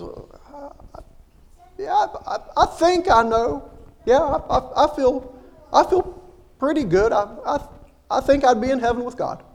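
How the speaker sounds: high, halting nervous voice with a small laugh; nervous, unsure wife. Retold or imitated. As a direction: stammer it out, panicky and unsure. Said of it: "starts getting panicky, a little nervous".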